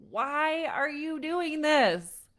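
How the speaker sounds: slow, loud, high exasperated why; mock exasperated parent. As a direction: draw out the frustrated question at the child.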